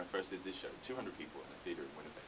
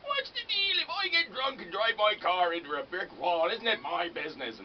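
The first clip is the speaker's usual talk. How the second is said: high, slow mocking Canadian accent; Canadian accent impression; 14 semitones higher, 12 dB louder, slower than usual. Imitating drunk Canadian neighbours, slow high Canadian accent. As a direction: drawl it out in an exaggerated Canadian accent. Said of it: "that's a Canadian accent".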